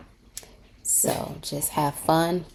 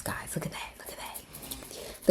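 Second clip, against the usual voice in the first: very soft hushed aside; amazed whisper; 13 dB quieter than usual. Real, not acted. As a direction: whisper it, drawing out the last words.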